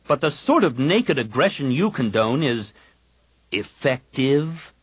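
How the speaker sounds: slow, drawn-out sneer. A narrator's reading. Kip sneering at the council's caution. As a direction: lean on it with contempt. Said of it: "Kip sneered".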